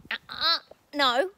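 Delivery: loud call to the dog, rising high; shouting the dog's name to stop a scuffle. Real, not acted. Owner shouting at squabbling dogs to stop. Scolding barking dogs mid-video.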